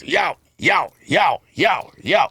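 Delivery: rapid-fire high "yo" with each swing; imitated cutlass fight. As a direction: act out the blades with repeated yelps. Imitates two men swinging cutlasses.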